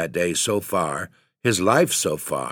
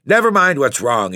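a loud, higher snarl; 7 semitones higher, 6 dB louder than usual. Acted. Father snapping at his son, angry snarl. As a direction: cut him off in anger. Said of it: "he snarled".

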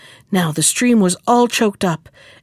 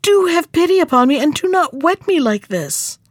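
high, pleading storybook voice; acted desperate entreaty; despairing cry; 6 semitones higher than usual. A narrator's reading. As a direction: beg for pity, pitched up. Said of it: "he cried in his despair".

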